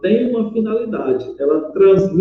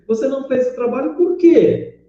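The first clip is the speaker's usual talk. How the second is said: raised pitch peaking on "por quê"; questioning intonation; 7 semitones higher than usual. Shown as a demonstration. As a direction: ask it pointedly, pitch climbing to "quê" then dropping. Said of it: "dar uma entoação dizendo assim". (entoação de pergunta, subindo no "por quê")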